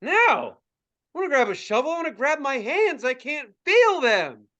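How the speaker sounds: high-pitched mock teenage protest; whining protest. Teen refusing dad over numb hands, whining.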